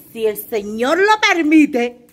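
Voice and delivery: loud, slow announcement; talking loud. Real, not acted. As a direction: announce it loudly and slowly. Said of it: "ya voy a hablar duro".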